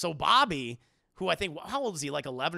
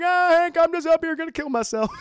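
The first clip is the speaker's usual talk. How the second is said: much higher and much louder cartoon whine; loud hammed-up Bill; 12 semitones higher, 11 dB louder than usual. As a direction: wail it out like the sad neighbor on the roof. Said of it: "And it's like".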